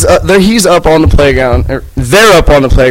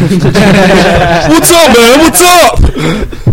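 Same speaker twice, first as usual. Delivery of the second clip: high mocking bro greeting with a laugh; Jersey Shore guy; 5 semitones higher, much slower than usual. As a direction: ham up a swaggering Jersey Shore dude. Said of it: "the Jersey Shore syndrome".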